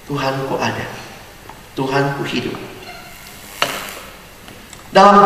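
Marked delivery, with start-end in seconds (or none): 0.0-2.5 much softer, inward declaration; hushed affirmation of faith; quiet confession. Acted. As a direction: say it softly to yourself.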